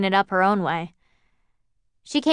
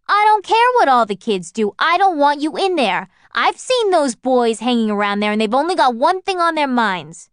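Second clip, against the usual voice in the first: loud, high, stressing don't; scolding mother; 6 semitones higher, 6 dB louder than usual. Acted. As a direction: lay down the law, angry. Mother forbids the luncheonette.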